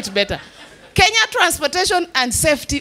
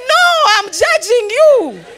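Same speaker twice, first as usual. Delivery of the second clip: 1 mock retort; 8 semitones higher, 5 dB louder, slower than usual. Retorting to the matatu's slogan.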